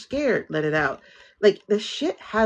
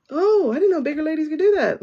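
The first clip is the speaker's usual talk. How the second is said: high, put-on voice of pleasant surprise; mock amazement; 10 semitones higher than usual. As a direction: play a wide-eyed onlooker who is impressed. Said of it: "stuff that you're like".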